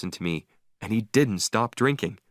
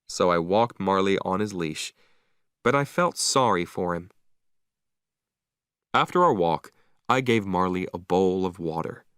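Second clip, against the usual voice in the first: ordinary delivery, nothing marked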